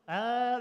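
loud, high held "ahh"; high-pitched attempt. Shown as a demonstration. Pastor trying his high voice for the staff, high and loud. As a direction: strain up to the top of a limited range. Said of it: "about as high as I can get".

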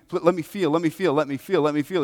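loud, high, repeated mock begging; mock pleading. Preacher voicing a believer asking God for feelings, mock pleading. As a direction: imitate someone begging insistently, pitch up.

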